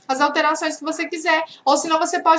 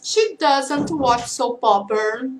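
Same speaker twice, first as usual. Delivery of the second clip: slow model answer; slower than usual. Shown as a demonstration. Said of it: "ou você pode responder".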